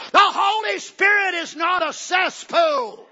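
high, loud, slow shout of the quote; imitating Brother Branham shouting. Preacher quoting Brother Branham's warning, slow loud shout. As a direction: thunder it out like the preacher on tape. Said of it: "You ought to hear him say this on tape".

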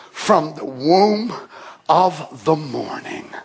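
low, soft, slow verse; hushed reverent reading. Real, not acted. Preacher reading the verse reverently, hushed. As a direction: let it fall to a hush.

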